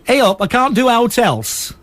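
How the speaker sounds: slow, broad Yorkshire; Yorkshire accent. Shown as a demonstration. Co-host showing off the one accent they can do, Yorkshire. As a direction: put on a measured Yorkshire accent. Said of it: "I can do a Yorkshire accent".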